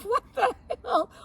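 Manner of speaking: high, loud disbelief; mock-panicked, high-pitched outburst; retold alarm. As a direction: cry it out in amused shock. Retells shock at revving engine.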